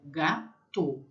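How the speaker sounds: slow "gato" split as ga, to; syllable-by-syllable demo. Shown as a demonstration. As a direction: sound out French "gato" slowly, closed final o.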